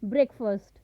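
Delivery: ब्रेकफास्ट said brek-fust, short unstressed second syllable; breakfast with a reduced fust ending; teacher models the right way; correct breakfast. Shown as a demonstration. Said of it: "सही उच्चारण होगा वो होगा".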